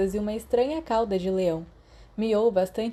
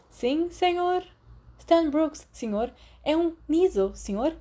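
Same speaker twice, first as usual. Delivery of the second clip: lightly raised, eager schoolboy voice; eager pupil; 8 semitones higher, slower than usual. Acted. Boy answering the professor, a touch of eager schoolboy. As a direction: answer like a keen first-year.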